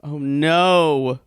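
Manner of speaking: ordinary talk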